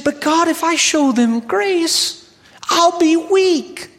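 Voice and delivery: high, slow pleading protest; whiny complaint. Acted. Seminarian protesting to God, high and whiny. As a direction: beg off like a reluctant student.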